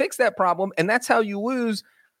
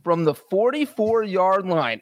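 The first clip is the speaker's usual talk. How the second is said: usual speaking voice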